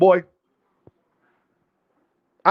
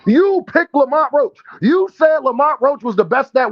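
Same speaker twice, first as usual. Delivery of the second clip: heated callout; 7 semitones higher than usual. Real, not acted. Calling out a chat rival.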